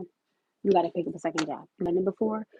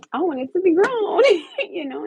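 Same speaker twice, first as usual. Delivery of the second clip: laughing with joy; 11 semitones higher, 8 dB louder than usual. Real, not acted. Joy at getting her own place.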